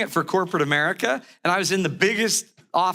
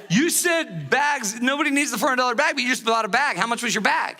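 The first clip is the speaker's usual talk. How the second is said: higher-pitched, insistent nagging retort; mock indignant wife; 6 semitones higher than usual. Wife cornering husband over his golf bag, mock indignant. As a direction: press the point like a cross wife.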